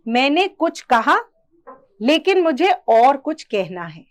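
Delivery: "मैंने कुछ कहा" held open, brief pause before "लेकिन"; comma pause. Shown as a demonstration. Teacher shows where a comma goes, pausing after "कहा". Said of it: "मैं बीच में थोड़ा सा रुकी".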